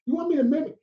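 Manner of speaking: surprised disbelief. Retold or imitated. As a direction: replay the shock of being asked to mock a friend. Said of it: "I go".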